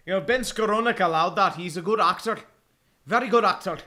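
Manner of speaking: put-on Irish accent drifting toward British and Scottish; badly faked Irish lilt; attempted Irish accent. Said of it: "that was kinda more British and Scottish".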